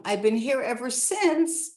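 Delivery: ordinary delivery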